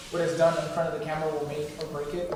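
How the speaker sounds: normal talking voice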